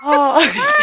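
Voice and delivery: ordinary delivery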